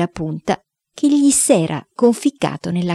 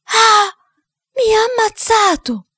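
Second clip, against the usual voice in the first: high, drawn-out scream of pain; high-pitched theatrical wail; agonized yell; 3 dB louder, slower than usual. A narrator's reading. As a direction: cry out as if mortally wounded. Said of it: "si udì un urlo".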